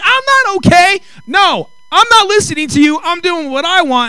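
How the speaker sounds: high, loud frantic protest; panicked outburst. Retold or imitated. Pastor panicking at the attendant on the tube, frantic loud protest. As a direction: shout it like you're about to go over a cliff.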